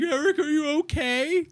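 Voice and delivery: high, mock-tearful fussing; imitated distraught concern. Teacher checking on Eric, mock distraught concern. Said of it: "and she was like".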